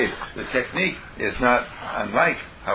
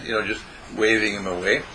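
ordinary talk, nothing marked

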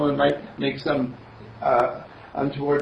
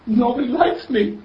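put-on much higher, louder child's whine; sad little girl; 11 semitones higher, 5 dB louder than usual. Little girl tells Mister Candle nobody likes her, whiny. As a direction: play a sulky little girl. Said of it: "And she says".

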